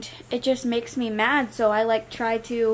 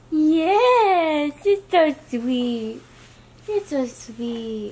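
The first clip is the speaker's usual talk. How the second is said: tender praise; 4 semitones higher, 3 dB louder, much slower than usual. Real, not acted. Praising crawling baby.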